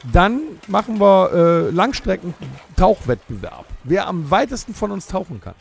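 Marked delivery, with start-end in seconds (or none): none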